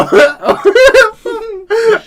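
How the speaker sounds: wheezing shriek of laughter; helpless high laughter. Real, not acted. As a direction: lose it completely laughing. Cracking up at the video.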